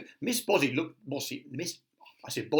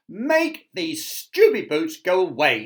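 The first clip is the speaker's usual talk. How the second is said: loud, slow angry demand; bossy shout; 8 semitones higher, 12 dB louder than usual. Acted. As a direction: yell at the wizard. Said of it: "shouted Miss Bossy".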